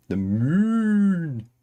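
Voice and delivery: high, lowing cow voice on the moon; a silly high cow voice mooing the word; cow impression. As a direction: moo the answer like a cow. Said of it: "You didn't even see my cow impression".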